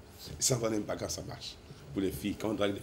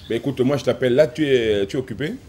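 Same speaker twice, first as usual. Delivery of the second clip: shouting on bad phone line; 4 semitones higher, 8 dB louder than usual. Real, not acted.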